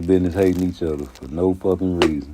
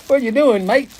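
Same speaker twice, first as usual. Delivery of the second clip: high, loud mock Australian accent; Australian impression; 4 dB louder than usual. Australian visitor lost in Chicago greeting locals, hammed-up Australian accent. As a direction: ham up an Australian tourist in Chicago, pitched high. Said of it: "from Australia".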